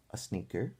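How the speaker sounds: ordinary delivery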